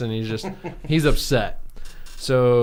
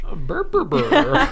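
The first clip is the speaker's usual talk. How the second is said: high-pitched burbling monster talk; creature babble; 13 semitones higher, 3 dB louder than usual. Acted. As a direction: burble nonsense syllables up high. Voicing Grimace's creature language.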